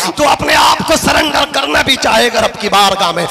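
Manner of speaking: full-force sermon with music behind; preaching. Acted. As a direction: preach it out to the crowd.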